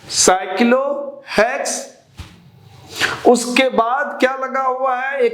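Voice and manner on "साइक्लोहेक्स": drawn out, pausing inside the word; teacher slowly spelling out a term; slow dictation. Real, not acted. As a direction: break the term in two as you write it. Dictating the name while writing.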